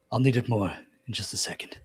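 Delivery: breathless whispered aside; a wounded man whispering to his healer; pained whisper. Acted. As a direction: whisper it through the pain.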